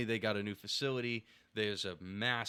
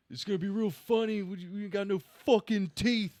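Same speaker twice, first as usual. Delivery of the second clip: high, loud mocking heckler shout; imitated heckler; 10 semitones higher, 6 dB louder than usual. Heckler cutting in as the crowd settles, mock shout. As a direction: call it out like a heckler over the crowd.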